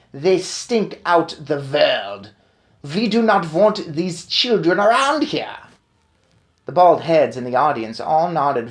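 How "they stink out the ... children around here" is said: put-on slow, accented witch voice; witch rant. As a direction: sneer at the children. Grand High Witch continues ranting.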